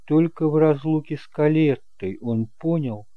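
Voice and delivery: ordinary delivery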